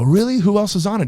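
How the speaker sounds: can't believe his luck, voice jumping up; excited disbelief. Retold or imitated. Surprised at arena tour offer.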